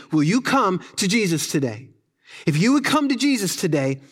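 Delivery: ordinary talk, nothing marked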